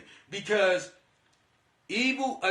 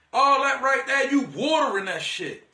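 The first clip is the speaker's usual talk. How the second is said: ordinary delivery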